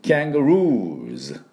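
キャンガルーズ said with a bright キャ and stretched ルー, final ズ; English pronunciation. Shown as a demonstration. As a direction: say キャンガルーズ like English kangaroos, stress on ルー. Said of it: "キャーンなんですね".

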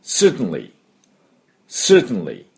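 "certainly" as "sert-nly", falling at the end; repeat-after-me model. Shown as a demonstration. Teacher asking learners to repeat, "certainly" as falling "sert-nly". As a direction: model "certainly" clearly, T straight into N. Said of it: "私の後続いてどうぞ".

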